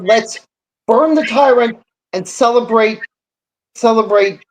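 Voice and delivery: usual speaking voice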